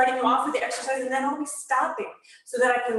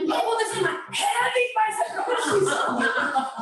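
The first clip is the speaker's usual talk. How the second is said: slow hammed-up groan of a heavy lift, higher and laughing; jokey overdone gym-bro strain; mock strained lifter; 8 semitones higher, slower than usual. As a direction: pretend the curl is way too heavy. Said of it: "I don't want you going".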